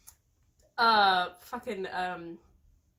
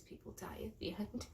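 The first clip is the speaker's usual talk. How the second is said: hushed ominous whisper; 15 dB quieter than usual. Retold or imitated. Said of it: "he's like".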